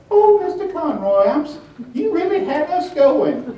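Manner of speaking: high, loud gushing lady voice; mock-gush like a delighted fan; sweet Southern lady. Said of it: "and say".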